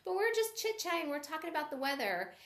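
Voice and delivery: amazed at the time passing; surprised. Retold or imitated. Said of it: "I'm like".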